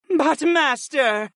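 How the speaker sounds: high, slow, pleading protest. A narrator's reading. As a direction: whine a plea to their master. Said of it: "the Arkans protested in unison".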